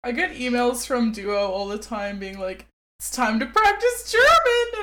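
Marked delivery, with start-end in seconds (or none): 3.0-4.8 loud, high-pitched mock cheer; imitated nagging email, high and loud; pushy app reminder. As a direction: shout it like an overeager notification.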